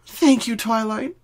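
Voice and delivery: a small thank-you with a smile; grateful Pinky. A narrator's reading. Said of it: "Pinky said, giving a soft smile back".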